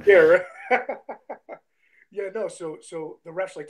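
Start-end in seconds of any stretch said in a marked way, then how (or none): 0.5-1.6 high burst of laughter; amused high-pitched laughing. Real, not acted. Laughing at the host's joke.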